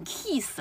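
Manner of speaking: kiss as a stiff "keess", long ee; stiff long vowel. Shown as a demonstration. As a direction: mimic the mistaken kiss with a tense, long vowel. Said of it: "你这太僵了".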